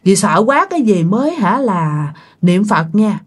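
usual speaking voice

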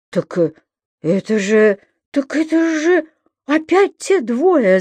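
slurring, stumbling over words; town drunk. A narrator's reading. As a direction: speak with a tangled tongue, slowly. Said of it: "заплетающимся языком проговорил Жабман".

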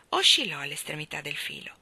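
usual speaking voice

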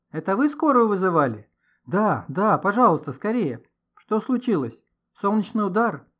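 pressing and agitated; urgent exchange. A narrator's reading. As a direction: hurry them along anxiously. Urgent exchange as ambulance arrives.

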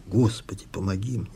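hushed, fading inner prayer; inward cry. A narrator's reading. Daughter turns away and begs God inwardly, hushed inner cry. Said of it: "прикричала в душе глубоко-глубоко".

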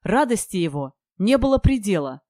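ordinary delivery, nothing marked

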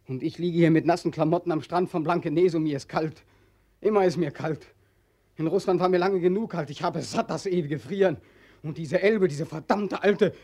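cold, angry cry against the Elbe; bitter outburst. Acted. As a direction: rage at the river and the cold.

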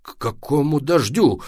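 exasperated near-yell, held back; almost shouting. A narrator's reading. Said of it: "почти закричал папа".

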